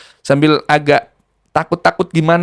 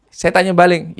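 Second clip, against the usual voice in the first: usual speaking voice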